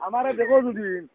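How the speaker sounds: ordinary delivery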